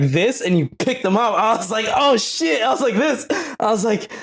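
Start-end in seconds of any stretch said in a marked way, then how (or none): 1.9-4.1 high, surprised yelps through a grin; retold shock, voice jumping up high; mock surprise. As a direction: play the shocked kid, laughing. Retold surprise at pants lift.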